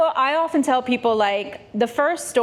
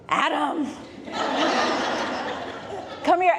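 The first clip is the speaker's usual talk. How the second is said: Eve calling Adam; 2 semitones higher, 4 dB quieter than usual. Retold or imitated. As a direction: summon him sweetly, stifling a laugh. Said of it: "she's like".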